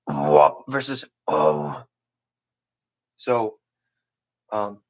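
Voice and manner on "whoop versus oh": low, slow growling bass tones; throat bass demo. Shown as a demonstration. Teacher demonstrating two bass tones to students, low slow growl. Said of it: "the whispery throat bass versus the tight throat bass".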